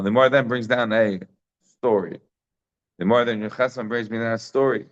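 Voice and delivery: ordinary talk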